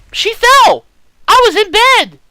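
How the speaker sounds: put-on high, loud outburst of a child protesting innocence; shrill child protest. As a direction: cry out a child's indignant denial.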